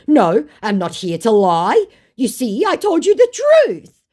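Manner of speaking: high, loud mock indignation; indignant scammer.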